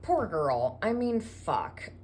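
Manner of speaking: usual speaking voice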